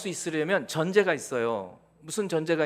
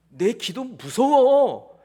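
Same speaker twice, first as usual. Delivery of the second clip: awed believer; 4 semitones higher, 7 dB louder, much slower than usual. Retold or imitated. Believer's awe voiced.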